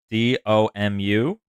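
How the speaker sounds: letters read slowly one by one; spelled out slowly. Shown as a demonstration. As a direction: spell each letter deliberately. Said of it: "spelling the name".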